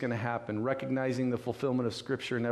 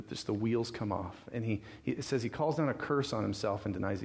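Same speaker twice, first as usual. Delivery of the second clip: ordinary talk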